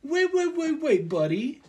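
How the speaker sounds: loud, high-pitched play-acted objection; mock outraged protest. Gamblers arguing over a flush, loud mock outrage. Acting out a casino dispute.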